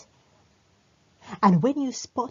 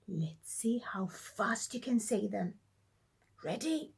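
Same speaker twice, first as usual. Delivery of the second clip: whisper; 7 dB quieter than usual. Acted. Teacher whispers the challenge.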